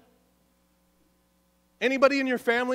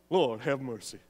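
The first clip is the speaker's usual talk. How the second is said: lightly hammed [name] for the congregation; [name] impression; 9 semitones lower than usual. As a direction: do your best [name] on it. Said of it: "your best [name] impression".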